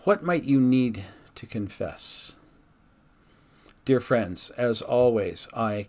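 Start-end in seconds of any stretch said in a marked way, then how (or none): 0.1-2.4 earnest reading of a devotional text; pastor's reflective reading voice. Acted. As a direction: read it to the congregation with gentle weight. Reads devotional entry aloud.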